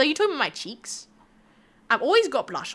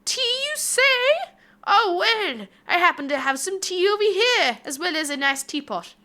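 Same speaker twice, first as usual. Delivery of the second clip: hammed-up British host; 7 semitones higher than usual. To chat, offering tea in a hammed-up British voice.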